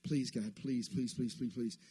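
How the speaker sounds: low, soft, anxious pleading; acted pleading prayer. Acting out a desperate prayer to God, hushed pleading. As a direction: plead under your breath, piling up each please. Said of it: "that's how we pray sometimes".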